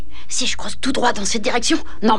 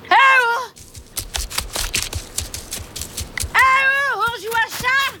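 calling out; 10 semitones higher than usual. Acted. Appelle les autres au loin.

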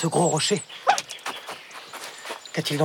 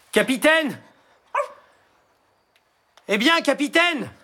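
calling out; 10 semitones higher than usual. Acted. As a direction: call out for the captain. Searching for missing captain.

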